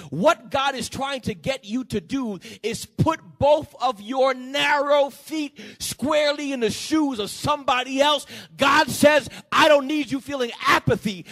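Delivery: loud, high, driving sermon climax; full-force preaching. Real, not acted. Preacher urges congregation toward empathy for others, full-force preaching. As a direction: build and hammer each phrase at the congregation.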